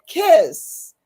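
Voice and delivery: loud, high-pitched one-word verdict; retold blunt advice. Professor advising a student to simplify, loud mock pronouncement. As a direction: imitate the professor, punching it high.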